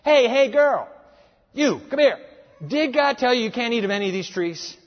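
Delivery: loud, high, coaxing come-on; a mocking tempter luring the woman; sleazy serpent. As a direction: call her over like a slick con man. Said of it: "How dumb".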